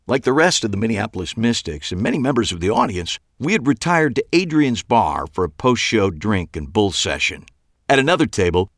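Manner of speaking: usual speaking voice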